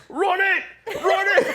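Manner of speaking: laughing yell, high and loud. Retold or imitated. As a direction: shout it through laughter. Friend yelling while running lights.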